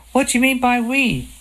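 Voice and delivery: high shocked question. A narrator's reading. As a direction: hit it in disbelief. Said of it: "I said shocked".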